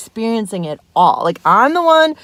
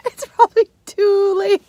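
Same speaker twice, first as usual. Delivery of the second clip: mock crying; 10 semitones higher than usual. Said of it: "Oh, wait".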